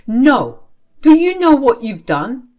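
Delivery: loud, high, emphatic confrontation; angry accusation. Acted. Angry questioning of child kept from bed, loud accusation.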